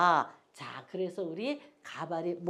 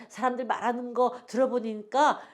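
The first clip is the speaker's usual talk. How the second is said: ordinary talk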